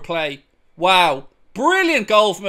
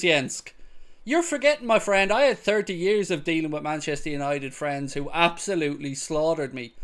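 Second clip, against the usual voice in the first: ordinary delivery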